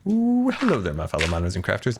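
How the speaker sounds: hammy YouTuber greeting; old show intro. Shown as a demonstration. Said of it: "that was my old intro".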